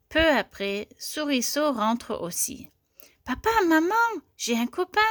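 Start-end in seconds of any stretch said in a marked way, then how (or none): 3.2-5.0 high, loud calling to parents; excited child. A narrator's reading. Young mouse tells parents of a friend, excited, high. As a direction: burst in with news, pitched up.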